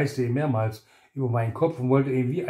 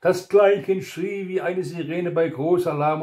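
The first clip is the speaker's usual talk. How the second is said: ordinary delivery, nothing marked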